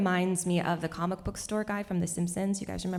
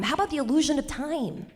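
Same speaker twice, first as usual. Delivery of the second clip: high, annoyingly chipper lisped suggestion; jolly lisping man; 8 semitones higher than usual. Retold or imitated. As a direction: play the unfazed, jolly lisper. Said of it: "he was, like, annoyingly jolly".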